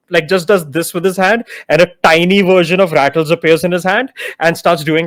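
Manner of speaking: usual speaking voice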